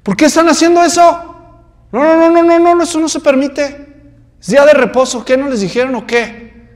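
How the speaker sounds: angry Pharisee. Acted. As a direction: scold them, outraged that rules are broken. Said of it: "Vienen enojados".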